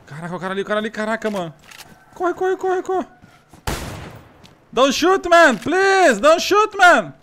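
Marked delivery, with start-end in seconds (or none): 2.1-3.1 panicked high-pitched cries to run; frantic, high, fleeing in panic; being chased. Real, not acted. Armed player chasing him in game. 4.7-7.1 loud, high, panicked pleading; begging for life. Real, not acted. Begging armed player not to shoot.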